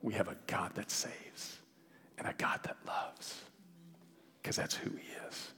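soft, breath-heavy assurance; hushed, reverent. Real, not acted. Preacher closing on a God who saves, hushed and tender.

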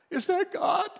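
high, wondering "Is that God?"; awed question. Retold or imitated. Pastor voicing the atheist's awe, high wondering question. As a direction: ask it in disbelief and awe.

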